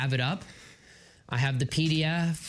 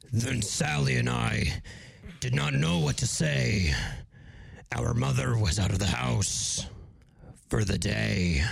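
demonic voice; 4 semitones lower than usual. Acted. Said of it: "evil person now, so deep demonic voice".

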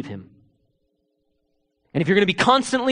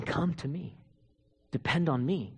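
hushed whisper; 4 semitones lower, 12 dB quieter than usual. Acted. The Lord calling a weary believer, whispered. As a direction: whisper it as the Lord's gentle call. Pastor voicing the Lord's call.

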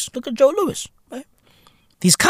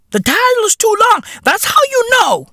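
incredulous rant; 10 semitones higher, faster than usual. Real, not acted. Mocking ranting repeat.

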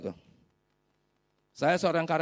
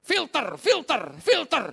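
rising high, louder each time; urgent self-reminder; 12 semitones higher, 6 dB louder, slower than usual. Real, not acted. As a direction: drum it into yourself, building. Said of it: "Hampir ingat lagi". (mengulang makin keras dan tinggi)

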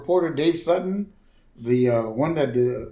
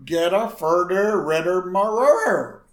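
1 mock garbled Homer; 5 semitones higher, 3 dB louder, slower than usual.

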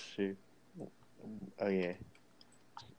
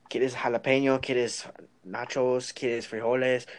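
louder and higher mock cashier rattling off Spanish; rapid Spanish offers; 5 semitones higher, 7 dB louder than usual. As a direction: flex on him with quick Spanish. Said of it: "He's like".

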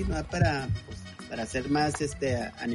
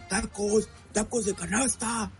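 high, slow hawker's cry; street vendor call; 7 semitones higher, slower than usual. Retold or imitated. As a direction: call out like a taco seller. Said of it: "sale el vato".

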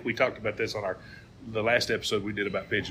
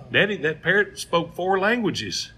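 excited son; 9 semitones higher, 5 dB louder than usual. Retold or imitated. Son voiced in joke.

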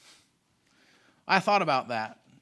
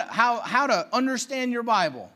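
usual speaking voice

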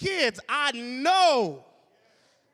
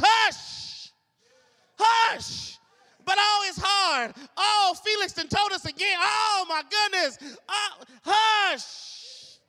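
higher, louder stern hush mixed with mock moaning; Caleb hushing; 6 semitones higher, 5 dB louder, slower than usual. Caleb silencing the grumbling crowd, stern and raised. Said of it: "Caleb says".

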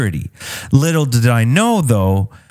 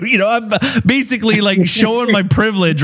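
ordinary talk

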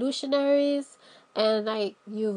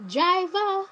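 calling out; 8 semitones higher than usual. Pretend. Calling to imaginary limo driver.

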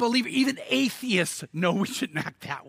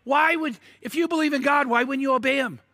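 high, raised-voice disbelief; shocked outcry; 6 semitones higher than usual. Retold or imitated. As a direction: cry out like a stunned sailor. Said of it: "They're, like, shocked".

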